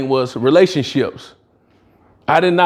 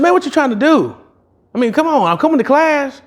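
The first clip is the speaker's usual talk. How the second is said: high-pitched, exasperated disbelief at the teacher; indignant protest; 11 semitones higher than usual. Retold or imitated. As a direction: come on, what is this, pitched way up. Retelling protest to his teacher.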